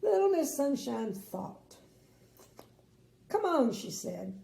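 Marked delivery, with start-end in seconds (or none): 3.3-3.7 high, loud coaxing; cheery urging. Acted. Little Miss Sunshine urges the king, high bright call. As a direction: coax him along brightly. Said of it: "cheery urging".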